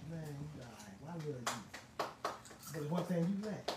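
soft, slow, hushed telling; hushed, slowed voice of Jesus; gentle quoted Jesus. Retold or imitated. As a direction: ease the words out softly, like a kind teacher. Jesus voiced speaking to young man.

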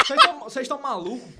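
loud mock scolding; scolding lieutenant. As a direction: bark it like an officer.

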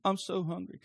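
lightly voiced whiny four-year-old; a hint of a kid's whine; whiny child. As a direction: imitate a small boy pestering his dad. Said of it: "because the whiny voice".

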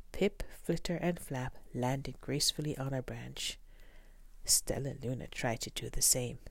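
plain reading voice

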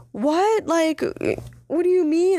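very high, incredulous questioning; imitated confusion. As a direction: mimic a baffled passenger, pitch shooting way up. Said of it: "She's so confused".

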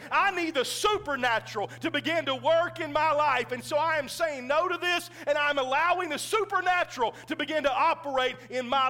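loud, high, impassioned preaching; full-force preaching. Acted. Pastor urging the congregation toward the supernatural, full-force preaching. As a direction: build it up and preach it to the rafters.

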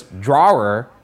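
"drawer" drawn out as a query; questioning pronunciation. Shown as a demonstration. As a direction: read "drawer" slowly, testing the sound. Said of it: "three pronunciations of drawer in question marks".

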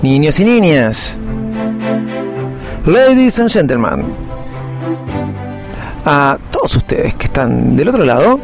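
big announcer intro voice; ringmaster. Acted. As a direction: welcome the crowd like a ringmaster.

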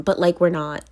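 normal talking voice

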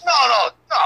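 high, loud mock no no no; imitated shout. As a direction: cut in with a loud, high-pitched protest. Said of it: "He goes".

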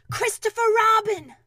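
high, very loud shout of a name; urgent, carrying call. A narrator's reading. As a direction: holler for a friend below. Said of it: "he called down to you again".

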